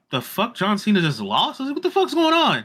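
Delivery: high-pitched, baffled outburst; retold disbelief. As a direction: climb high in comic disbelief. Retelling his shock at the loss.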